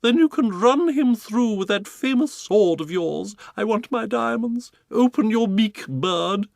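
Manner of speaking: high, loud, slow diva cry; Duchess crying out. Acted. Duchess demanding the burglar, high loud cry.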